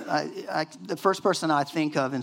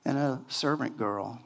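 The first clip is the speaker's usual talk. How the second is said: ordinary delivery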